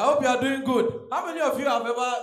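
ordinary talk

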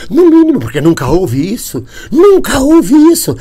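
mock outrage. Exaggerated outrage.

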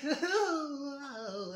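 high laughing burst; real laugh. Real, not acted. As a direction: break up laughing mid-line. Laughing fit after the line.

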